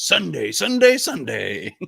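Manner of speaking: loud, slow hyped announcer boom; monster truck announcer. Retold or imitated. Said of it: "monster truck announcer voice".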